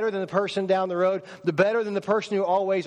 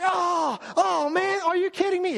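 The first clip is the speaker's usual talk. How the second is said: much higher, shocked yelp of disgust; a boy recoiling in horror at the brownies; disgusted kid; 12 semitones higher than usual. Acted. Boy shouting in disgust at his mother's brownies. Kid told about dog poop.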